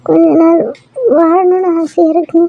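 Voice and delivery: halting, broken, each word a struggle; barely holding on, stammering out each word; faltering dying words. Acted. Dying wife to her weeping husband, halting and broken. Bleeding wife begs to be saved.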